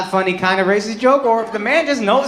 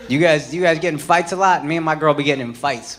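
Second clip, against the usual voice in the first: ordinary talk, nothing marked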